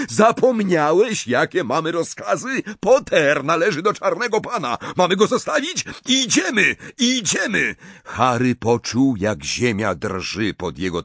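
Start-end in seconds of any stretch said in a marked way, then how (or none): 0.1-7.7 high, insistent shouting; calling out orders. A narrator's reading. Snape reminding Death Eaters of their orders, shouting. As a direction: call commands, pitch climbing toward the end. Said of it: "Snape wołał".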